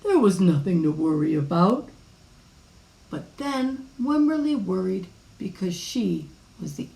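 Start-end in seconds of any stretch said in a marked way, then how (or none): 0.0-1.9 low father's voice, rising at the end; a hint of a confident dad; reassuring father. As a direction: reassure in a lower, firm tone. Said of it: "said her father".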